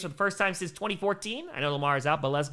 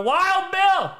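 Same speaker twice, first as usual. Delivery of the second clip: loud call-out; 13 semitones higher, 7 dB louder than usual. Real, not acted. Reading chat line loudly.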